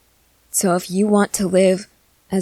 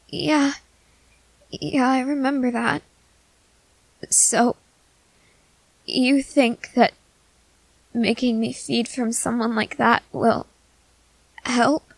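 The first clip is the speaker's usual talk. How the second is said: put-on nervous young companion; 5 semitones higher than usual. Companion confessing fear of feeding to the vampire, nervous and higher. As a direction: let the worry sit under every line. Companion doubts his plan.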